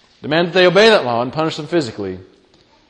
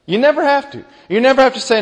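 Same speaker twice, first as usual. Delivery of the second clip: usual speaking voice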